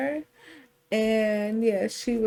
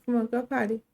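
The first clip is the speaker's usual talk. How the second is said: usual speaking voice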